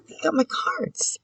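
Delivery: high, slow and soft, playfully astonished; mock amazement. Imitating herself spotting her cards on another channel, high mock surprise. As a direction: squeak it out like you're caught off guard. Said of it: "I'm like".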